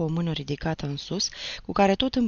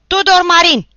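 far higher, very loud roar; yelled name; 14 semitones higher, 16 dB louder than usual. Acted. The teacher out-yells the recruits, roaring. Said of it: "strigă el".

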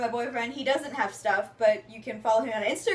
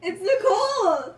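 loud, higher, drawn-out call; calling out name; 7 semitones higher, 5 dB louder, slower than usual. Real, not acted. Closing the video by calling out her name loudly. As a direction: shout your name out slowly.

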